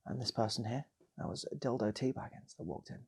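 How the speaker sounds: ordinary delivery, nothing marked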